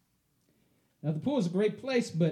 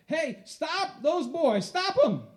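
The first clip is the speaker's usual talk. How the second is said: high, slow call across the pool; mimicking a woman yelling across a pool; yelling lady; 10 semitones higher, much slower than usual. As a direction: call out to stop the thieves. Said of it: "I hear a lady across the pool yell".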